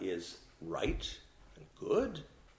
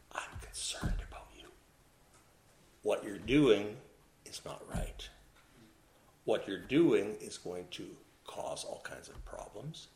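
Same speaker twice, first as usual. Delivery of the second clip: soft whisper into the ear; 4 semitones lower, 7 dB quieter than usual. Shown as a demonstration. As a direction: whisper it close, like a caring friend. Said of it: "very quietly say to you right into your ear".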